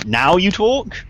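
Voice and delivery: huff, then ask it in disbelief; exasperated. Acted. To the device that stayed silent, exasperated. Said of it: "I just give an exasperated".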